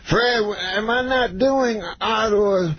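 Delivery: puzzled star asking his agent; Cosby impression. To his agent Fred, slow mock Cosby.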